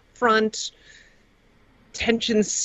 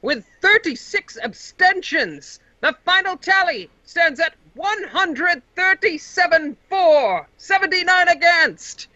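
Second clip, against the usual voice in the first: magically amplified voice; 9 semitones higher than usual. Acted. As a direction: boom it out like an amplified herald. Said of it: "the announcer stands up once again".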